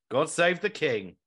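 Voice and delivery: ordinary delivery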